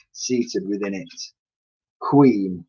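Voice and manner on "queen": plain reading voice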